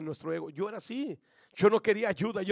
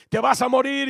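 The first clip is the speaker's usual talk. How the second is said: voices; 4 semitones higher, 9 dB louder than usual. Retold or imitated. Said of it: "yo oía las voces".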